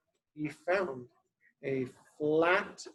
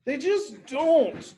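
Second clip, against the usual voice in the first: grumpy tortoise; 9 semitones higher, slower than usual. Acted. Tortoise's grumpy reply to Robin.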